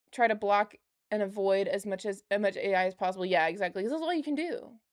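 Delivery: ordinary delivery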